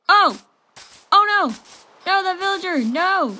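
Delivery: loud, high startled shouts; high panicked yelps of alarm; alarmed cries. Real, not acted. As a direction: cry out as it gets hit. Villager attacked in game.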